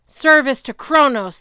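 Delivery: booming titan rage; titan roar. A narrator's reading. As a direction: bellow it like a god. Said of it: "the titan roared".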